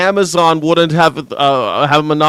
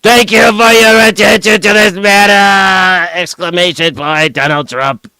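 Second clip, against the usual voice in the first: slow, louder, higher mock Trump post read; hammed-up old president reading his own post; 4 semitones higher, 3 dB louder than usual. Mocking the president's all-caps post in a slow, louder Trump voice.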